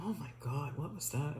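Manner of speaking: a soft, low stunned gasp of words; hushed astonishment. Retold or imitated. Body suddenly moves in ambulance.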